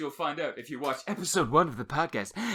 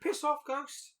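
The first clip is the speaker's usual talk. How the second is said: put-on lightly done New Zealand accent, higher; Kiwi accent; 12 semitones higher, slower than usual. Quoting a film character, a light New Zealand accent. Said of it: "it's a New Zealand accent".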